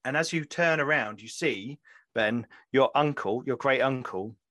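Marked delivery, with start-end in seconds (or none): none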